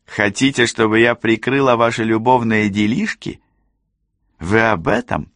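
high, disdainful questioning; scornful challenge. A narrator's reading. Rosalinda questions Cassie about covering the affair, high and scornful. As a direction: throw it at her in a high voice.

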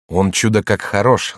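usual speaking voice

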